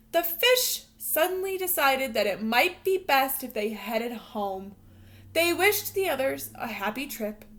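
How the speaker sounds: expressive read-aloud storytelling voice; storybook narration. Acted. Teacher reading a picture book to her students, lively storytelling voice. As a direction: read it like a bedtime picture book for little kids.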